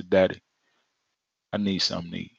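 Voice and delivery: low, soft, unhurried; calm single request. Retold or imitated. Child asking father once, softly. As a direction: ask once, trusting.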